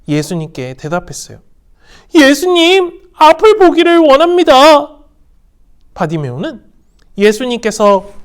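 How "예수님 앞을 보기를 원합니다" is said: high, loud sincere plea; earnest request. Acted. Bartimaeus asking Jesus to heal his eyes, earnest high plea. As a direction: ask earnestly to see. Blind man answering Jesus.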